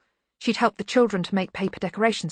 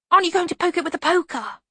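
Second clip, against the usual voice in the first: put-on high, fast child's voice; eager, quick, high-pitched question; eager small boy; 11 semitones higher, 4 dB louder, faster than usual. A narrator's reading. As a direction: rattle it out high like an impatient boy. Eager boy eggs her on.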